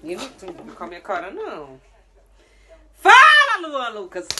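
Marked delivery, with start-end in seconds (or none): 2.9-4.3 high, loud yell calling his full name; furious yell. Real, not acted. Mom screams son's full name in anger.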